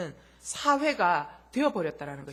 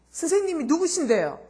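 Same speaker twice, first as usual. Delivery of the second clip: part-timer's retort; 9 semitones higher, 8 dB louder than usual. Retold or imitated.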